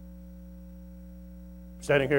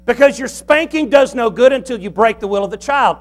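usual speaking voice

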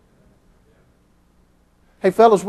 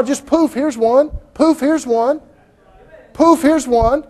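mocking magician; 7 semitones higher, slower than usual. Mocking magicians conjuring Bibles.

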